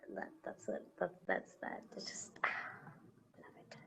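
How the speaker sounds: barely audible, awed murmur trailing into whisper; moved whisper. Real, not acted. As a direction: whisper it, overcome by the quote. Moved after reading the quote.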